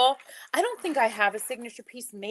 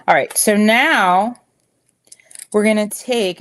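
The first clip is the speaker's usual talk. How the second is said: yelling; 11 dB louder than usual. Real, not acted. Host walking online viewers through the necklace trick, yelling. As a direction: raise your voice over the livestream, slowing right down. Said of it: "if I'm yelling".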